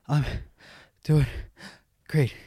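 gasping one word per breath; patient off the ventilator answering the nurse, out of breath; breathless. Retold or imitated. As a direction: squeeze each word out on a single shallow breath. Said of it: "that's all the lung capacity I had, single breaths".

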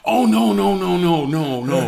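high, loud string of protests; mock alarm, easing into a laugh; retold panicked refusal. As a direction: refuse in a comic hurry. Hastily refusing the offered girl.

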